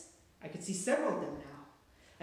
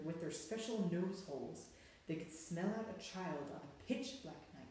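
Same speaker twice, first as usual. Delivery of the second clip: ordinary delivery, nothing marked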